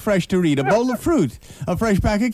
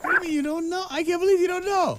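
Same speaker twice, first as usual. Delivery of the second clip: much higher, softer mock amazement; mock disbelief; 11 semitones higher, 4 dB quieter than usual. Host to caller Barbara, mock shocked disbelief. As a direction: gasp it out in fake shock.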